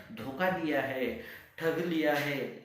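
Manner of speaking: slow, loud, hammered words; slow angry emphasis. Acted. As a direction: spit out each word in anger. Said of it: "गुस्सा आया".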